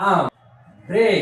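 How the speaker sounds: "আম", pause, "ব্রে", each alone; split into syllables. Shown as a demonstration. Teacher breaking umbrella up for students, syllable by syllable. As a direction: cut the word into separate beats, "আম" then "ব্রে". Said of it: "তাহলে আমরা কি বলি?".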